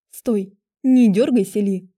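loud, slow stern command; the witch snaps at Li while lacing her corset. A narrator's reading. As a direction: order her to hold still, firmly. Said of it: "строго сказала ведьма".

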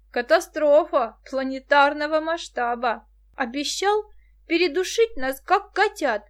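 outraged, loud and high; mock his words in outrage; indignant. A narrator's reading. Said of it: "возмутилась помешанная на кошках Муся".

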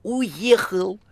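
high, exclaiming phone voice; crying out. A narrator's reading. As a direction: call it out high. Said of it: "кричала трубка". (высокий крикливый возглас)

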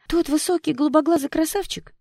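put-on very high, loud girlish question; astonished, high-pitched gossiping; thrilled disbelief. A narrator's reading. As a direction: squeal it as scandalized gossip. Shocked gossip asks who it was.

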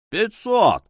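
loud, high sudden call; sharp ringing shout. A narrator's reading. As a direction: ring it out across the crowd. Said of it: "вдруг воскликнул резкий звучный голос".

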